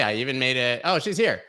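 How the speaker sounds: ordinary talk, nothing marked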